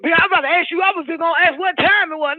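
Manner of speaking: high whiny mock voice of the old man. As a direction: mimic him pleading in a high voice. Mocking the old man's excuse.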